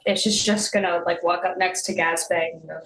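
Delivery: ordinary talk, nothing marked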